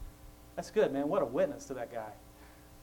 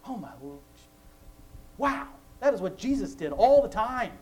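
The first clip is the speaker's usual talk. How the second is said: surprised realization; 8 semitones higher, 8 dB louder than usual. Real, not acted. Sudden realization about parables.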